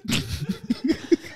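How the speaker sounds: high-pitched giggle; laughing. Real, not acted. Stuck on which animal sings it, high laughing. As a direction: crack up while stuck for an answer. Cracking up at absurd question.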